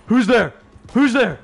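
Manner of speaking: high, loud mock-panicked demand; alarmed challenge. Voicing startled people in a dark bar, alarmed challenge. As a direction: imitate a jumpy shout at an intruder.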